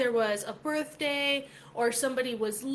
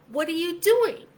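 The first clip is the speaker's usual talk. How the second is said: exasperated; 6 semitones higher, 6 dB louder than usual. Retold or imitated. Exasperated inner voice at coworker.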